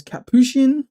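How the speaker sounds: Capuchin offered as one possible pronunciation; variant pronunciation. Shown as a demonstration. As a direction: try out "Capuchin", stressed on the middle syllable. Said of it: "I don't know how people pronounce it".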